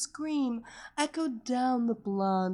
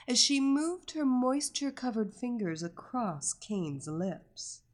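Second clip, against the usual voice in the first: usual speaking voice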